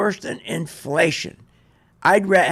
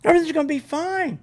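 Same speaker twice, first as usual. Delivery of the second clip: high, sugary mock reassurance, much higher; mocking soothing advisor; 9 semitones higher, faster than usual. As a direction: ham up a smug broker calming a nervous client.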